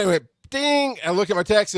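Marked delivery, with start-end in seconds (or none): none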